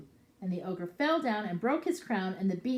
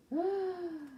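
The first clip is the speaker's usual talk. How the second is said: gasp; 11 semitones higher than usual. Real, not acted. Reacting to ogre waking.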